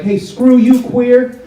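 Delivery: retold yell. Stranger yelling from a passing car, mock yell. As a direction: bark it like a jerk out a car window. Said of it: "somebody yelled out".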